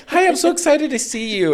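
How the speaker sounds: very high, eager mock greeting; poor person voice. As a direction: gush hello like a hammed-up poor fan. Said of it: "poor person that's like".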